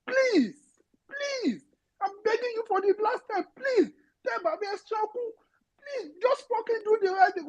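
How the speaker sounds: Pitch ऊपर, टूटती आवाज़ में विनती; रोते हुए गिड़गिड़ाओ; tearful begging. Real, not acted. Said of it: "that was quite passionate".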